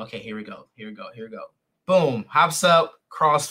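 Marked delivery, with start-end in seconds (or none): 0.1-1.4 much quieter, lower aside to himself; muttered bracing. Retold or imitated. Student readying for the demonstration, hushed resigned mutter. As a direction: mutter it under your breath, bracing yourself. Inner dread before the demonstration.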